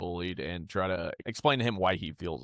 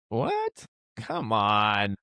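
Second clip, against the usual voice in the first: mock disbelief; much slower than usual. Dad reacting to the teacher giving other kids flowers, mock disbelief. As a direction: act out an incredulous reaction.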